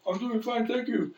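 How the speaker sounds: high, small puppet reply; sock puppet voice. Retold or imitated. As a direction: answer as the sock, pitched right up.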